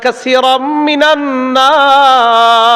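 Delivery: slow melodic chant with long held vowels; drawn-out sung recitation; Quran recitation. Acted.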